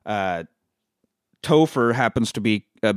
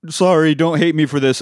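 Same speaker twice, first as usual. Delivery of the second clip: mock blubbering; 6 semitones higher, 5 dB louder than usual. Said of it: "he's just blubbering".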